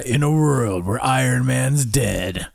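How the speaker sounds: slow dramatic trailer narrator; movie trailer voice. Pretend. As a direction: announce it like an epic trailer. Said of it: "I want the voice again".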